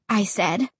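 ordinary delivery

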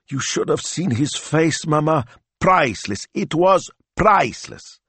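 delighted high-pitched gushing; gleeful delight. A narrator's reading. Gleeful teasing about his face.

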